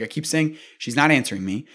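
normal talking voice

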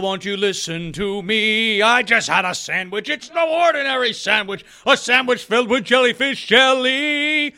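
slow, squeaky cartoon sponge voice; SpongeBob impression. Mocking a pandering pitch in SpongeBob's voice. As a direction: ham it up like a goofy sea sponge. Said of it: "devolved into SpongeBob".

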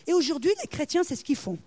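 normal talking voice

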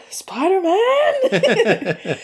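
very high, long drawn-out wail; hammed-up cartoon cry, laughing; mock exclamation.

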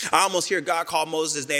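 normal talking voice

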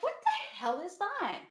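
high-pitched imitated alarm; retold startled reaction. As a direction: act out being startled by a crash.

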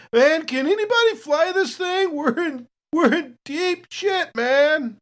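desperate shout for a pilot; panicked yell. Acted. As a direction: yell it over the chaos like the shuttle's going down. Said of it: "he's also gonna yell".

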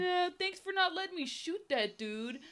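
high, goofy dismissive voice; mock offhand thanks. Thanking her after the failed shooting, high mock-casual voice. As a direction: toss off thanks in a silly high voice. Mocking the gift-giver's offhand gratitude.

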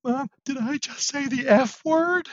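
put-on high, slow voice of a worried six-year-old; a small boy asking his mom, scared he did wrong; little boy voice. Scared six-year-old asking his mom, in a high slow child voice. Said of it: "He goes".

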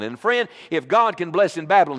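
normal talking voice

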